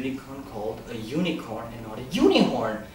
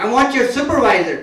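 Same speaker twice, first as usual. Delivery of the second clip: loud, higher-pitched irate demand; heated complaint, voice raised; angry; 7 semitones higher, 6 dB louder than usual. Acted. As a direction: demand it like a fed-up customer. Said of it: "the guy's getting angry".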